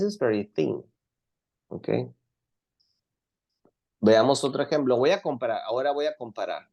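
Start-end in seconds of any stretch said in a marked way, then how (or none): none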